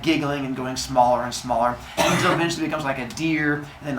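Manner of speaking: ordinary talk, nothing marked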